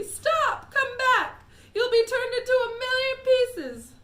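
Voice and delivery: very high, loud frantic pleading; screaming mother. Acted. Mother shrieks at Mike to come back. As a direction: shriek to stop him. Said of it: "screamed Missus T V".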